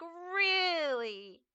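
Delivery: "Really?" drawn out high with exasperation; drawn-out exasperated really. Retold or imitated. Reacting to a quick unlike.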